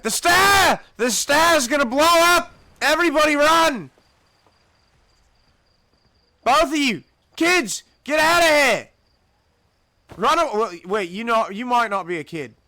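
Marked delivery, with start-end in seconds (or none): 0.0-10.4 loud, high frantic yelling; panicked screaming. Pretend. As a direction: shout for everyone to run. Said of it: "That's what I would be saying".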